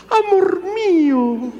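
very high, slow, syrupy falsetto; high sugary "Amor mío" of the old days; sweet girlfriend voice. Retold or imitated. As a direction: coo it like a lovesick bride. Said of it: "aquella vocecita tan suave cuando eran novios".

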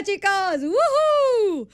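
high, squealing whoop of joy; excited cheer. Real, not acted. Host cheering on air about her solo shift, squealing happily. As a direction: let out a delighted woohoo.

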